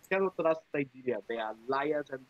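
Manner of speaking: put-on quiet, low Ugandan accent; lightly imitating dad's Ugandan accent. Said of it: "It's a very good Nigerian accent".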